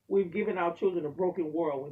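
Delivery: normal talking voice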